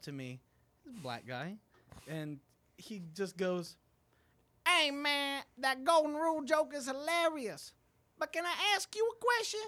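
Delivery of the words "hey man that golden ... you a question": high, put-on voice of a fellow comic; pitched-up impression of a comedian friend. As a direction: mimic the other comic, high and teasing. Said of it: "you do an impression of a fellow open mic comic".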